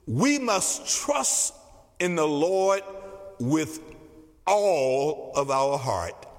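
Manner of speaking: slow, weighty stress on all; drawn-out emphasis, voice sinking low on heart; emphasized. Shown as a demonstration. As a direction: lean hard into all of our heart. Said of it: "And I want to emphasize".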